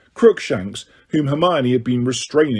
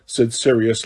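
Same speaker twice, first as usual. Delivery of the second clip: ordinary delivery, nothing marked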